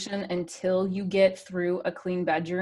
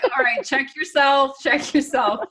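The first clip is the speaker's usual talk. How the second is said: loud, high, comically worked-up; mock angry self-talk; 7 semitones higher, 6 dB louder, slower than usual. Coach retelling how she answers her child's reminder, mock angry. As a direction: tell yourself off, loudly and playfully. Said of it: "I get so angry".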